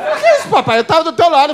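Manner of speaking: put-on child's voice. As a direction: play the betrayed little son. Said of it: "Eu falei".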